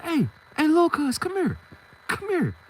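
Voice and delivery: high-pitched summons; calling after someone. Retold or imitated. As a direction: imitate someone calling a kid back. Calling after him on the block.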